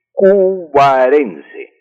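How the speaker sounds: "cubarense" dicho muy despacio, sílaba por sílaba; slow, syllable by syllable. Shown as a demonstration. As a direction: alarga cada sílaba de "cubarense" como dictando. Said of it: "el gentilicio de los nacidos en Cubará". ("cubarense" pronunciado muy lento y silabeado)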